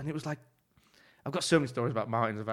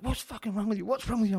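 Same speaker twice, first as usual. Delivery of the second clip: angry chef; 10 semitones higher than usual. Retold or imitated. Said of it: "and he's like".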